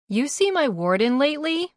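loud high holler. A narrator's reading. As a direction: call it across the room. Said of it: "I hollered to Boink".

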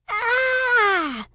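put-on high, drawn-out little-girl scream; long squealed yell of embarrassment; child's yell. A narrator's reading. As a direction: scream high like a mortified kid sister. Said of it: "she yells".

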